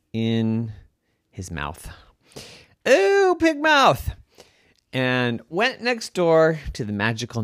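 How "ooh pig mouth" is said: high, loud squeal; squeaky aside. Acted. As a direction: squeak it in amused disgust.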